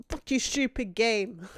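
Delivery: loud, slow spat-out curse; angry curse. Real, not acted. Streamer loudly curses the stupid game.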